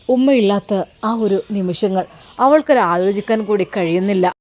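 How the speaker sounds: ordinary talk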